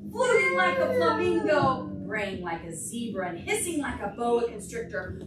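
storybook chant; reading to children. Acted. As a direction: chant the book's refrain for toddlers. Reads picture book to children.

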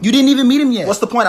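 put-on teen pushing back at mom, high and loud; exasperated teen. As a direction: object in disbelief.

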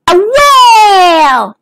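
high, long, loud wail of a word; excited reveal. Acted. Dennis reveals the I Spy answer, excited drawn-out cry.

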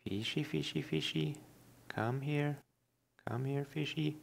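soft, high coaxing call; talking to fish. Real, not acted. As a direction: sweet-talk the fish closer. Coaxing fish while fishing.

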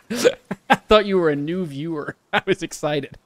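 talking through laughter; laughing. Real, not acted. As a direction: laugh while you get the words out. Amused at mistaking a friend.

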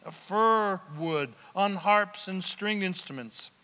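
ordinary talk, nothing marked

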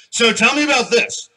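imitating bar patrons. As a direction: call it out like a curious customer. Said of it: "people come out and go".

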